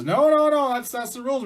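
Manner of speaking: high, loud string of no's; insistent protest. Acted. Beaver telling Mouse the rules stand, insistent.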